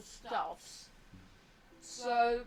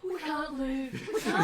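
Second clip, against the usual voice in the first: excited; 8 dB louder, slower than usual. Real, not acted. Cheering at free treatments.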